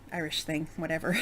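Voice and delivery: ordinary delivery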